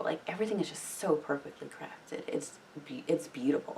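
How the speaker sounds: hushed, breathless whisper of admiration; awed whisper. Real, not acted. Viewer whispering in awe about the show's craft. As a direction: whisper it like you can't believe how good it is.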